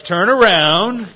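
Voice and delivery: loud, high, drawn-out command; acted stern order. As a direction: order the guards straight back. Pharisees ordering guards back.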